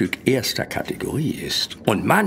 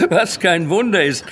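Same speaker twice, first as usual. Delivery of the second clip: laughing; 7 semitones higher, 4 dB louder than usual. Acted. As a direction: chuckle it out, amused and pleased. Flattered dealer laughs at customer.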